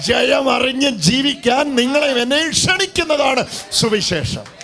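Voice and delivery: high, loud revival exhortation; full-force preaching. Acted. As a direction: rouse the congregation with a rising cry.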